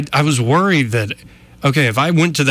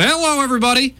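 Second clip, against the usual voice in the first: cheery greeting; 12 semitones higher, faster than usual. Retold or imitated. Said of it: "I walk in and go".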